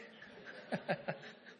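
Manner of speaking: a breathy chuckle at his own joke; real laugh. Real, not acted. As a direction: laugh it off softly.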